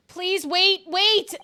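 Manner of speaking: high, loud cry to stop; urgent plea. Acted. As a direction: shout to halt the proceedings. Acted plea to halt court.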